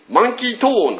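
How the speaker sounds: lightly high mangled name; a hint of a foreigner's twist; mock American mangling. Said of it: "なんて". (外国人風に名前を崩した軽い言い方)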